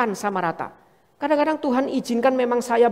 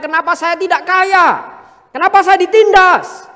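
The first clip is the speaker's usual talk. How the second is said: higher, louder whiny complaint rising to an outburst; mock grumbling; 7 semitones higher, 7 dB louder than usual. Preacher voicing a grumbling believer, mock whiny outburst. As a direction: play the bitter complainer protesting.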